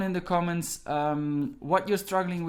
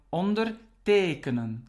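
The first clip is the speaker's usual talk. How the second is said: "ondertekenen" slowly, with the stress on TE; slow demo of "ondertekenen", TEkenen stressed; stress on second part. Shown as a demonstration. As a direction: hold back "onder", then lean on TEkenen in "ondertekenen". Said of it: "the stress is on the second part".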